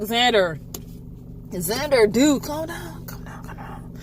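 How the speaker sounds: hushed, soothing whisper; calm him with a whisper. Retold or imitated. Narrator hushing Xander to calm down, whispering. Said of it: "I'm like".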